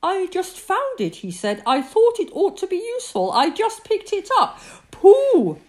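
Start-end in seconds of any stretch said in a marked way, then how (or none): 0.0-1.2 high, simple character voice; innocent Pooh. A narrator's reading. Pooh tells Christopher Robin about the pole, innocently. 1.7-4.6 high, simple character voice; innocent Pooh. A narrator's reading. Pooh tells Christopher Robin about the pole, innocently. 4.9-5.6 loud, weighty address; solemn. A narrator's reading. Christopher Robin addresses Pooh, solemn and loud.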